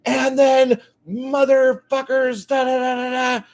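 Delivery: high, loud, mocking rant voice; hammed-up crazy guy yelling outside Starbucks; ranting street yeller. Street ranter yelling at Starbucks customers, high and loud mockery. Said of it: "he's still yelling again".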